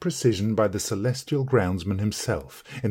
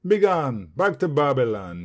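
loud, grave command; solemn banishment; 6 semitones higher, 5 dB louder than usual. A narrator's reading. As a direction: cast him out like a prophet. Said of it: "he said, solemnly and irreproachably".

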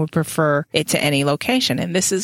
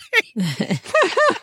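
high breathy laughter; 12 semitones higher than usual. Real, not acted. Podcaster laughs at the mosquito joke.

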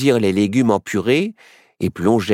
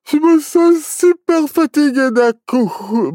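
high, drawn-out yawn through the words; slow, stretched words swallowed by a yawn; 5 dB louder, slower than usual. A narrator's reading. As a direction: talk through a big sleepy yawn. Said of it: "bâilla Barnabé".

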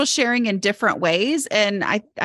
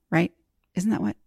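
ordinary talk

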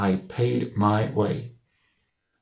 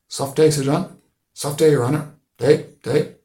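raised, loud passing greetings; hailing greeting; 5 semitones higher, 9 dB louder than usual. A narrator's reading. Locals hail the squire riding past, loud and higher. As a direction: call out deferentially to the gentry.

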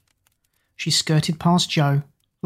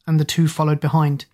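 plain reading voice

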